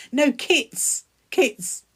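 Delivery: "kits" said emphatically with a crisp T-S ending; "kits" repeated as a clear correction; correcting KITS. Real, not acted. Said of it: "That's me and my funny accent".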